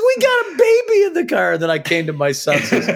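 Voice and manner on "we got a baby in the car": high, loud panicked cry; shrieking warning, very high; alarmed shout. Retold or imitated. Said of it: "She goes".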